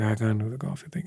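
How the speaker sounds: wife too tired for the date. Retold or imitated. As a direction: sound drained, with nothing left to give.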